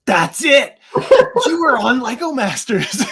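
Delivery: high, loud, laughing that's it; excited recognition. Retold or imitated. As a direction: shout it out as the penny drops. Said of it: "he was like".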